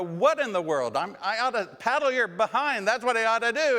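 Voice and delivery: high, loud hollering threat; mock furious yell. Parent threatening a paddling over the lunch, mock furious holler. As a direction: blow up at the kid like a furious parent. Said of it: "And you're yelling and you're hollering".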